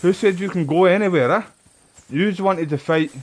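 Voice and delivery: loud gloating taunt. Real, not acted. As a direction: bark it like a brawler who won't let him leave. Taunting enemy in a fight.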